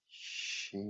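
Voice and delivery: Shing breathed very softly, almost whispered; quiet delicate tone. Shown as a demonstration. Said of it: "the last one's a bit more quiet".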